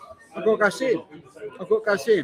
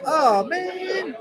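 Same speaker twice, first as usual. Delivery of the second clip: high-pitched; 13 semitones higher than usual. Pretend. Reading a chat message aloud.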